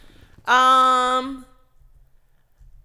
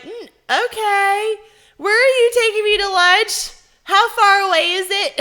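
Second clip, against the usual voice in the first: loud, high-pitched uneasy questions; mock nervous; 9 semitones higher, 8 dB louder than usual. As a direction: play up the alarm, high and loud. Retold uneasy reply about gun.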